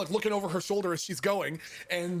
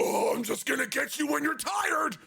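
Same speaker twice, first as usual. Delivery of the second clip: menacing taunt; 7 semitones higher than usual. Retold or imitated. Man chasing a stranger by mistake, menacing yell. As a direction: holler it like a pursuer.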